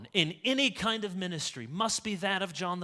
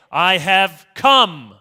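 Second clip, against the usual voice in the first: "come" held long, much louder and slow; drawn-out grand pronouncement; 16 dB louder, much slower than usual. Retold or imitated. Mocking Jesus' grand phrasing.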